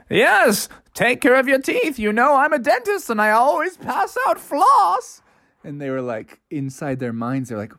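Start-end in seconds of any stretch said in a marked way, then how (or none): 0.1-5.2 higher, cheery dentist voice; higher rabbit dentist lecturing kindly; jolly dentist rabbit. A narrator's reading. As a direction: hand out floss like a proud dentist. Dentist rabbit hands out floss.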